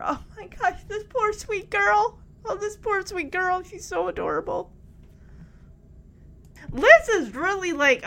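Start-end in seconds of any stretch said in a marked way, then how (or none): none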